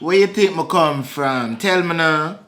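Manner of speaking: put-on Jamaican patois; Jamaican accent. Asking her to guess his origin in a Jamaican accent. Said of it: "you just doing the accent".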